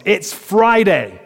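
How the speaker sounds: high, loud, slow yell; full preacher's cry; yelled at the top of his lungs. Retold or imitated. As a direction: shout the word out to the whole church. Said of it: "he just yelled at the top of his lungs".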